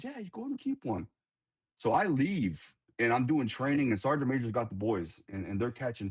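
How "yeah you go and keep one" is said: higher, offhand imitation of the owner; mock landowner voice. Quoting the owner letting the sergeant major keep a fish, higher and offhand. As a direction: toss it off like the generous old man.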